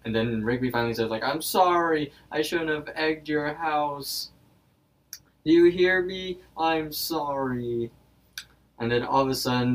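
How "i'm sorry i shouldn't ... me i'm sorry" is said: high, slow mock-pleading; sad apology. Rigby finally apologizing to the wizard, acted sadness. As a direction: plead forgiveness in a raised, drawn-out voice. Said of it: "looks down in sadness".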